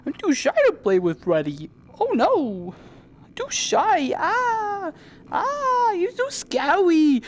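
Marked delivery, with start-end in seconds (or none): 2.0-7.2 high, hammed-up frightened squeal, slowing at the end; much higher mock-terrified whine; mock scared shy voice. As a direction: squeal like a timid kid. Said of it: "'Cause I do the, you know".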